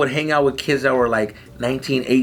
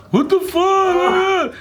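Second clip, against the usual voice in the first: shocked; much slower than usual. Retold or imitated. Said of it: "he's like".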